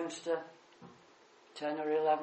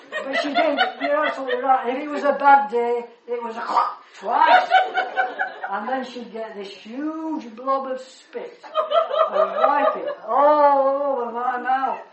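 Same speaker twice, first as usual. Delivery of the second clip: loud, high laughing through the words; can't stop laughing while telling it; real laughter breaking into the story; 7 semitones higher, 14 dB louder than usual. Real, not acted. Laughing through a funny memory.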